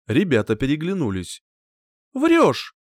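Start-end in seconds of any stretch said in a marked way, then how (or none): 2.1-2.8 high-pitched defiant exclamation; challenging. A narrator's reading. Sceptical girlfriend accusing the hero of lying, challenging. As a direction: fling it out as a challenge. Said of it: "с вызовом произнесла Фелиция".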